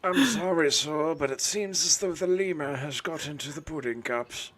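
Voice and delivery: high-pitched mock-British manservant; put-on British butler. Butler regretfully reports to sir, in high fake British voice. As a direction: apologise like a hammed-up English butler. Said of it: "we just need a Woodhouse".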